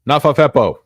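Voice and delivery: a booming goofy put-on voice; goofy shout. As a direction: belt the nonsense word like a cartoon.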